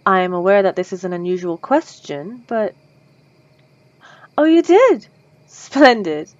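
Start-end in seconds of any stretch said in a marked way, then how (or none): 4.4-6.3 higher and louder, thrilled; vampire hears the listener donated, delighted and surprised; delighted surprise. Acted. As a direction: leap up at the good news. Delighted the donor said yes.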